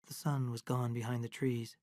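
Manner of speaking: ordinary delivery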